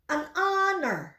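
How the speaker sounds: an honor said slowly, "an anor" with no h; slow model pronunciation. Shown as a demonstration. Teacher slowly models an honor for the class. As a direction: draw out an honor, dropping the H. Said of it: "Mari ikuti saya".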